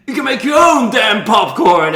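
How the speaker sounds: hammed-up angry shout, high and loud; imitating an indignant outburst; mock pissed-off. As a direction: yell it like a jealous sulker storming off. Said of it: "who's pissed that Brian is".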